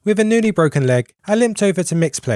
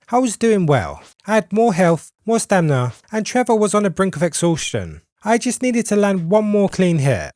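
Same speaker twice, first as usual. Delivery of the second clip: ordinary talk, nothing marked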